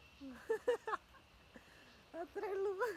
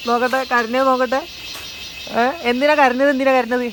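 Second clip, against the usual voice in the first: mock stern questioning; 7 semitones higher, 5 dB louder than usual. Real, not acted. Uncle questioning a crying child. (കരഞ്ഞ കുട്ടിയോട് ഉച്ചത്തിലുള്ള ചോദ്യം)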